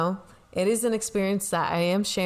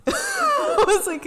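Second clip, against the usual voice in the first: laughing; 4 dB louder, slower than usual. Real, not acted. Laughing at mom's panic.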